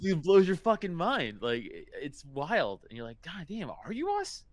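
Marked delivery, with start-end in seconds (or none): 0.0-1.4 loud and high, mind blown; excited. Real, not acted. Telling a friend about apes, loud excited. 3.2-4.4 high, stunned question; awed. Retold or imitated. Asking a gorilla if it is us, high awe.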